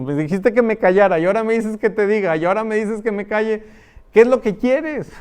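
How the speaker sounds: put-on high, loud and fast, a child protesting in exasperation; exasperated child. Child yelling at the scolding mother, a high, fast exasperated protest. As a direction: rattle it off like a fed-up kid pushing back.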